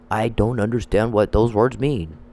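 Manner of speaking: slow, blank, playing dumb; mock clueless voice. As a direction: imitate someone who just doesn't get it. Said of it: "I get the same response".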